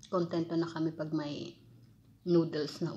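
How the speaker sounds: muffled words between bites; talking while chewing. Real, not acted. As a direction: mouth partly full of food. Seen 0: Bites orange pieces from fork; lips pursed, chewing between words.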